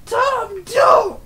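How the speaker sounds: high puppet screaming stop; panicked shout. Pretend. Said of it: "do a puppet show".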